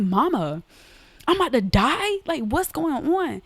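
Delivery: panicked cry. Retold or imitated. Girl asking her mama at the doctor's if she'll die, high panicked plea. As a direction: replay the kid's terror at the diagnosis. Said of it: "I'm screaming and I'm crying".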